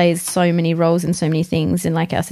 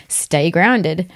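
normal talking voice

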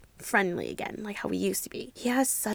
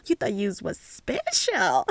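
slow, drawn-out taunt; sassy mockery. Teasing him for feeling special, mocking. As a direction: ham up the taunt, stretching the last word. Continuing mocking put-down, laughing after.

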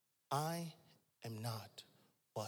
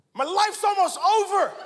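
mock panic; 8 semitones higher, 7 dB louder than usual. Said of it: "I said".

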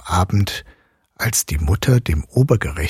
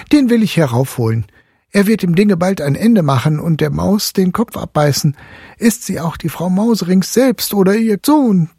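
put-on high, eager boy's voice; cheeky boy proposing a plan; merry boy; 12 semitones higher, 4 dB louder than usual. A narrator's reading. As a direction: boast cheerfully as young Fritz. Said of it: "fiel Fritz ganz lustig ein".

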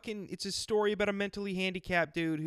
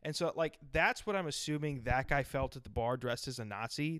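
usual speaking voice

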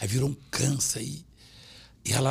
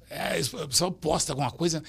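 usual speaking voice

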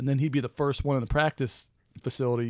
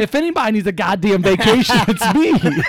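higher, louder, indignant; Jordan's voice; 8 semitones higher, 10 dB louder than usual. Retold or imitated. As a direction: snap it back as Jordan. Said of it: "Mike says".

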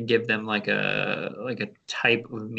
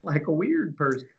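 higher-pitched teasing echo; playful echo; 5 semitones higher than usual. Pretend. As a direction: echo it in a silly raised voice. Playful high echo of friend's phrase.

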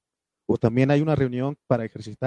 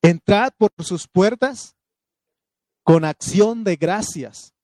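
high, loud and slow, declaiming; proclaimed verse; 5 semitones higher, 5 dB louder, slower than usual. Acted. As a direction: lift the verse up to the church. Quotes the psalm again.

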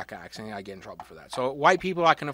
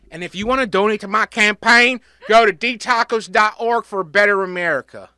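loud, raised-pitch stump speech; mock campaign pitch; 5 semitones higher, 11 dB louder than usual. Host pitching a joke campaign to listeners, loud stump-speech voice. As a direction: belt it out like a candidate rallying donors.

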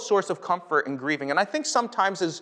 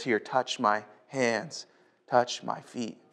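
tender invitation; 6 semitones lower, 6 dB quieter, slower than usual. Acted. Risen Jesus invites Thomas.

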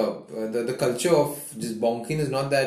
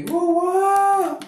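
excited Goa; 4 dB louder than usual. Real, not acted. Excited weekend plans, Goa.

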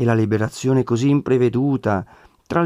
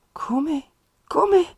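shocked. A narrator's reading. Mother hears shocking news.

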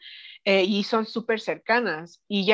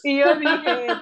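laughing; 6 semitones higher, 4 dB louder, much slower than usual. Real, not acted. Laughing while retelling the reaction.

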